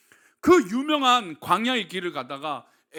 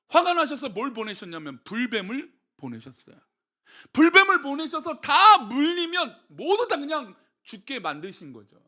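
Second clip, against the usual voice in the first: ordinary talk, nothing marked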